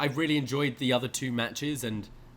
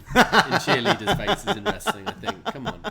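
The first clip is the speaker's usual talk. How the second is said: laughing; 8 semitones higher, 7 dB louder than usual. Real, not acted. Laughing joke.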